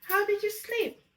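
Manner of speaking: high, bright sing-song hello to a little one; excited high-pitched good morning; excited greeting. Real, not acted. Cheerful greeting to a child.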